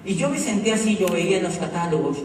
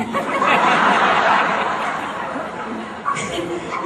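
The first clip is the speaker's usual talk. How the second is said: breathy laugh; 5 semitones higher, 5 dB louder than usual. Real, not acted. Laughing at own story.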